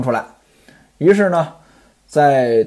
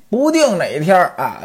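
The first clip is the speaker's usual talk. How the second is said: ordinary delivery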